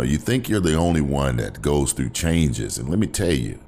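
usual speaking voice

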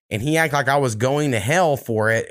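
ordinary talk

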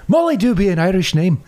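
light put-on Irish lilt; a hint of stage Irish brogue; fake Irish accent. Host answering co-host in a mock Irish accent. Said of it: "my terrible fake Irish accent".

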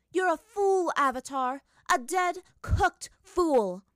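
high, loud furious villain yell; furious shout. Acted. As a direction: spit the insult in rage. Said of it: "displayed her absolute fury".

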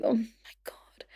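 slow whispered exasperation; dismayed whisper. Retold or imitated. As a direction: whisper it in drawn-out despair. Retells her dismayed reaction.

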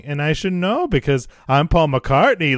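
hammed-up British impression. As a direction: imitate a bad impression, pushed high and loud. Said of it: "best impression".

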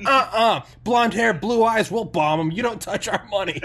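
high, loud sarcastic refusal; mock tough shout. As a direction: sneer it loudly as a bully nation. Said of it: "And we were like".

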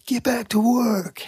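high, drawn-out mock yell; high-pitched imitation of a boss yelling; imitated top-of-lungs yell. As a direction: holler it like a boss, stretching the words. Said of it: "yells at the top of his lungs".

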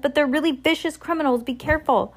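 high, excitable warning; agitated shopkeeper. A narrator's reading. Shopkeeper warns the delivery person about criminals, agitated.

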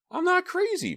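high-pitched outraged denial; exclaimed protest. A narrator's reading. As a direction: exclaim it, stung by the insult. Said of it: "Myra exclaimed".

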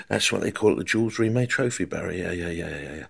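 quiet, low patient agreement; soft Bobby Moore. Retold or imitated. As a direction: murmur it kindly. Bobby Moore answers softly.

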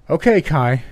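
nearly yelling. A narrator's reading. Doctor to patient crying in agony, nearly yelling. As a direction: raise your voice over someone's cries. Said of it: "He was nearly yelling so I could hear him".

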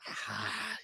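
long, soft exhaled sigh; deflated sigh. Real, not acted. Host giving up on ranking the receiver trio, sighing. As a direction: let out a quiet, resigned breath.

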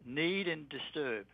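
slow, spelled-out dictation; dictating slowly. Shown as a demonstration. Guest dictating words to listeners, slowly. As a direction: give each word slowly so it can be written. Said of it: "write in".

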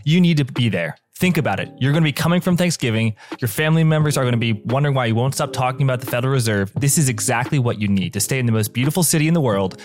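upbeat sponsor read, lightly sold. Acted. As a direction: pitch the event like a podcast ad. Host reads sponsor ad.

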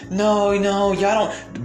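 dismissive mimic of a woman cutting him off; mocking dismissive woman. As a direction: brush him off in a mocking high voice. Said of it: "become very dismissive".

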